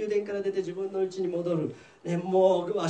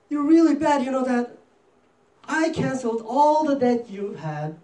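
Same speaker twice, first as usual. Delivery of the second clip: loud, raised-pitch scolding; angry rebuke; 6 semitones higher, 6 dB louder, slower than usual. Acted. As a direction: play an indignant king telling off his servant. King rebukes the unforgiving servant.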